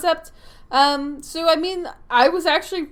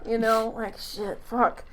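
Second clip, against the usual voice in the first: ordinary delivery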